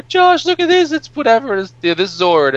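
put-on high, loud, excited child; excited kid voice. Kids showing each other toys, high excited mock child voice. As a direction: squeal like a kid showing off a toy.

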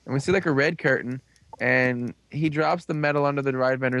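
normal talking voice

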